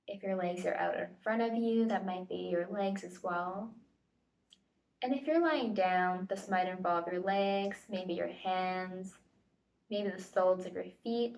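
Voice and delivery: ordinary talk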